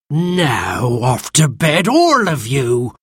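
slow, firm scolding voice; cross nursemaid, words drawn out; stern order. A narrator's reading. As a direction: send naughty children off sternly. Martha orders children to bed.

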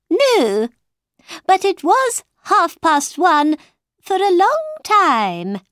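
high, emphatic Mad Hatter; Mad Hatter replying. A narrator's reading. Mad Hatter correcting Alice about the time, emphatically.

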